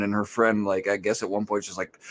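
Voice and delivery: ordinary delivery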